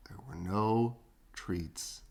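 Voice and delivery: hushed, slow storyteller whisper. A narrator's reading. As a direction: drop to a whisper for the sad part. Sad twist in story.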